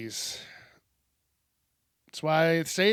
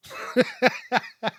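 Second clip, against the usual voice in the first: laugh; 8 semitones higher than usual. Real, not acted. Laughs at own pun.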